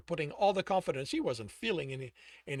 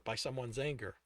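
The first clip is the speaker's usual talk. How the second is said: plain reading voice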